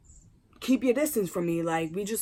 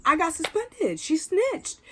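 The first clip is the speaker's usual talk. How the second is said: excited news; 9 semitones higher, 3 dB louder, slower than usual. Retold or imitated. Telling best friend the news.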